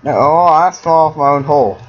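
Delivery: real loud groaning protest; dismayed cry. Real, not acted. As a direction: cry out at your own blunder. Falls in the game.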